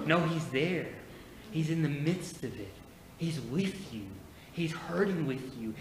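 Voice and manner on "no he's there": ordinary delivery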